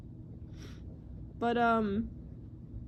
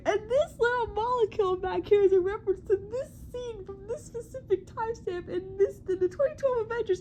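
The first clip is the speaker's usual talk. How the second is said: high-pitched over-keen breakdown host; Eric Voss; 7 semitones higher than usual. Retold or imitated. Mimicking a YouTuber spotting tiny references, mock keen analyst. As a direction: imitate a detail-obsessed analyst, pitched up. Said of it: "freaking Eric Voss will be like".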